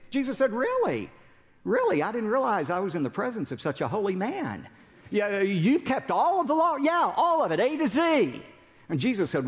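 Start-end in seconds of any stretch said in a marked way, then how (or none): 0.5-6.8 mock amazement. Jesus voiced mocking the ruler. 6.8-8.4 boastful ruler. Acted.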